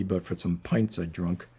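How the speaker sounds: usual speaking voice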